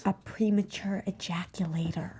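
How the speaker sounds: whispering gossip to Bert; whispered secret. Acted. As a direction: lean in and whisper it. Leans in to say secret.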